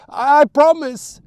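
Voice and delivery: loud, high, slow promise; shouted vow. Acted. Terrified boy swearing to his mother, shouted. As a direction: force the words out in terror.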